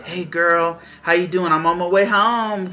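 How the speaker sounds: cheery. Acted. Boyfriend calling his girl after work, cheery. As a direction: play an eager boyfriend on his way home. Boyfriend happily calls girlfriend.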